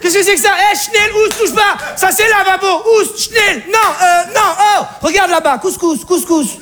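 very high, shrieking mock German-accented alarm; panicked Alsatian mother. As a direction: shriek at the intruder by the sink.

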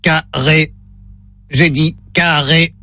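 slow low robot voice. Pretend. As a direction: deliver it like a slow talking machine. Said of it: "Voix de robot enclenchée".

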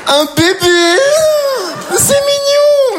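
very high, drawn-out cooing; squealing high baby-talk delight; mock gushing. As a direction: melt over the baby. Melting at mention of baby.

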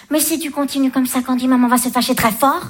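mock-threaten like a French mum; imitating mother. Mother scolding little Candy, mildly mock-stern. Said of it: "les fameux".

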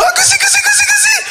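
put-on very high squealing baby talk; tickling baby talk. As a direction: coo it shrilly while tickling. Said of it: "Tu lui fais". (voix de chatouilles suraiguë)

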